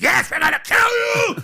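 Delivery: very high-pitched screamed threat; mock menacing shout. Storyteller acts cultists answering the victim, high screamed threat.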